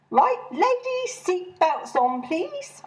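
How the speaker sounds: high, slow call to the whole bus; bossy chairwoman addressing the bus. Acted. Chairwoman calling out orders to the ladies, high and slow. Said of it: "I called out".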